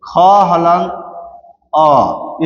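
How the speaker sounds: क हलंत, pause, then अ on its own; broken into parts. Shown as a demonstration. Teacher breaking क into consonant and vowel, pausing between parts. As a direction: split क हलंत and अ apart with a clear pause. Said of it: "इसको हम तोड़ेंगे".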